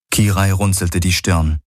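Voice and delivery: ordinary delivery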